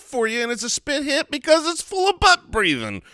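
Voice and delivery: high, stretched-out greeting to listeners; hyped show intro. Acted. Host greeting the Spitwads with a spit hit, high-pitched hype. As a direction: pitch it way up to tease the episode. Hyped podcast cold open.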